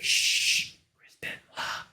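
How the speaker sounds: breathy unvoiced hush, half joking; shush, then barely breathe the name; hushed whisper. Real, not acted. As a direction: whisper the name like it's forbidden. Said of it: "Can't say the name".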